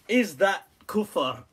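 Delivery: drawn-out rhetorical ask; slowed teasing question; slow mock question. Shown as a demonstration. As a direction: stretch each word, teasing. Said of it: "repeats question slowly".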